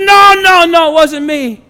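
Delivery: loud, high-pitched protest, acted out; emphatic denial. Preacher acts Peter denying to his accuser, loud frantic protest. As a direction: cry out a desperate mock denial.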